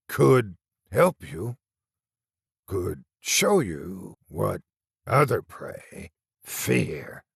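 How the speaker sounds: very slow rasping monster; a rotting thing whispering an offer; slow creature voice. A narrator's reading. Monster speaks in story.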